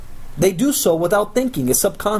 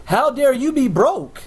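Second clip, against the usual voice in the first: higher, slow, mock-outraged turnaround; incredulous retort; 8 semitones higher, slower than usual. Coach turning the prospect's words back on him, mock outrage. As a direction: throw their words right back at them, drawn out. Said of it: "I'm like".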